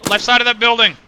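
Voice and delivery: loud, high urgent shout; shouted callout. Real, not acted. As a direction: yell it to your squad under fire. Calling out enemy under fire.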